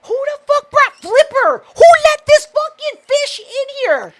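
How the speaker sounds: high, incredulous mock outrage; mock outraged yell. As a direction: act appalled that someone let a fish in. Retold outburst at laughing audience.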